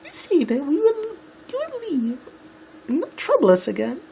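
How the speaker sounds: very high, badly faked falsetto; hammed-up high-pitched character voice; high-pitched Rarity. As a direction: squeak it out as a dainty pony lady. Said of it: "I can't do the high-pitched shit".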